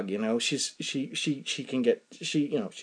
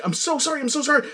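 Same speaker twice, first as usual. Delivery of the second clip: frantic apology; 10 semitones higher, faster than usual. Retold or imitated. Apologizing to the old woman.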